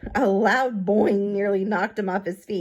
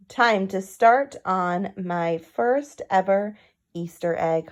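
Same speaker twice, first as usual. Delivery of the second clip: ordinary delivery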